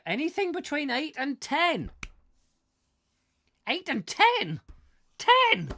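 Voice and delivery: loud, high-pitched outburst; incredulous shouting; worked-up disbelief. Acted. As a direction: exclaim it at the top of your voice. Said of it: "I need to calm down".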